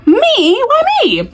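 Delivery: high-pitched incredulous squeal; comically incredulous, pitched high; mock disbelief. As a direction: go way up, as if you can't believe they picked you. Mock disbelief at being challenged.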